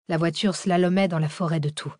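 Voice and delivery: ordinary delivery, nothing marked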